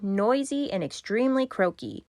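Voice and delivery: plain reading voice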